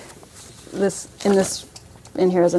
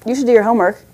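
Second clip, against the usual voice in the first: scolding parent; 2 semitones higher, 7 dB louder, faster than usual. Retold or imitated. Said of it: "instead of".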